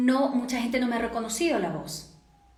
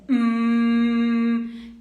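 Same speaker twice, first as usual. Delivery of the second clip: long, loud steady hum; sustained "mm" on one note; humming exercise; 7 dB louder than usual. Shown as a demonstration. Voice coach demonstrating an exercise, loud sustained hum. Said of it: "otro de los ejercicios".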